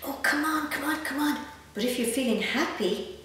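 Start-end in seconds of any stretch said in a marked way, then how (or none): 0.1-1.4 mock impatience. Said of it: "feel a little bit cross".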